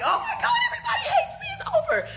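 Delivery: squealing way up high in mock panic, half laughing; hammed-up melodrama about online hate; mock despair. Mocking her old self crying over online hate, squealed in a mock wail. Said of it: "and I'd be like".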